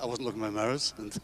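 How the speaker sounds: usual speaking voice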